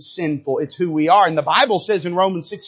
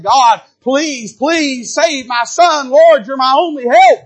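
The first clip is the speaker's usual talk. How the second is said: 1 desperate plea; 7 semitones higher, 6 dB louder, slower than usual. Pretend. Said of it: "reached out to God and said".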